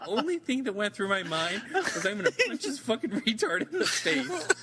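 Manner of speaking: words pushed out through real laughter, pitch high; telling it while cracking up; laughing. Real, not acted.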